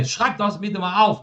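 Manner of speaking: high-pitched, rapid-fire command; loud, quick order. Shown as a demonstration. Teacher showing how an imperative sounds like an order, loud and quick. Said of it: "faladas num tom de voz alto, né, e rápido assim".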